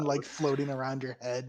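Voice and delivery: ordinary talk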